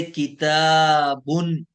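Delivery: किताबुन very slow, the final -un drawn out; tanween demo. Shown as a demonstration. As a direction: stretch किताबुन ending on a clear un. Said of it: "तनवीन हो गई".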